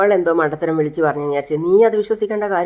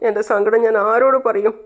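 ordinary delivery, nothing marked

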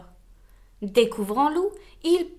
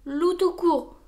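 grumbling; 5 semitones higher than usual. Acted. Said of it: "bougonna Loup".

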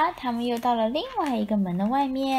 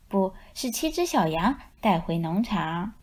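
ordinary delivery, nothing marked

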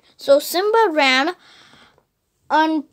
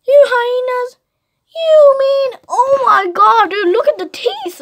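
high, loud telling-off; excited scolding; 7 semitones higher, 5 dB louder than usual. Real, not acted. Child shouting at the hyenas in the book. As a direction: scold them with mock outrage.

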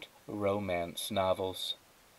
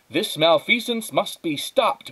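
higher, loud, slow heroic declaration; superhero voice; 8 semitones higher, 5 dB louder than usual. Retold or imitated. Janitor about to transform into Incandesto, heroic and slow. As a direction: proclaim it like a comic hero. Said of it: "what the unassuming janitor always said".

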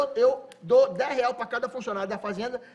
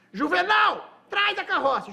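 high, loud shout across the yard; 9 semitones higher, 6 dB louder, slower than usual. Retold or imitated. The farm owner shouts to his foreman to prove his point, loud. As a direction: yell the order to Juvenal.

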